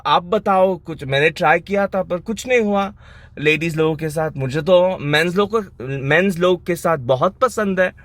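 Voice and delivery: hammed-up desperate plea for a remedy; mock pleading. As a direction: beg for a cure, hamming it up. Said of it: "I was like".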